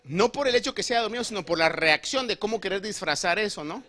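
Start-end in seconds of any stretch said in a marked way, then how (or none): none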